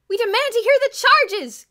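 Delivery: very high, very loud, slow protest; screamed demand. A narrator's reading. Kathy to the police, screaming. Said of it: "Kathy screamed again".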